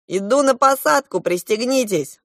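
high, loud pilot's call; announcing. A narrator's reading. Goose pilot to passengers before landing, announcing. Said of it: "оповестил злой гусь".

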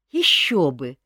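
eager, bright exclamation; enthusiastic. A narrator's reading. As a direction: burst out with delight. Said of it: "восторженно отозвалась та".

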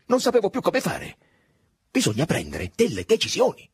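fussing and overwrought; agitated. Acted. Simeoni fusses anxiously at Drogo, high and flustered. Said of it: "con esuberante cordialità".

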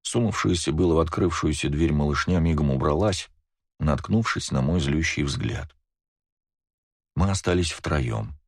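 ordinary delivery

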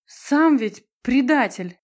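loud, high, drawn-out accusation; indignant outburst. A narrator's reading. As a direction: hurl it slowly in outrage. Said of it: "захлебнулась возмущением Брайлович".

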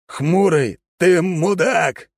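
furious slow yell; shout. Acted. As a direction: bellow an insult. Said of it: "заорал Алекс". (яростный крик)

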